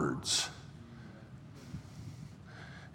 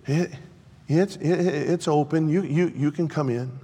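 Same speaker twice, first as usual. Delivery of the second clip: weak trembling voice; 6 semitones higher than usual. Acted. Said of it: "weak, trembling voice say".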